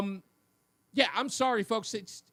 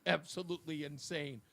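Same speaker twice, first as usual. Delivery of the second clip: ordinary talk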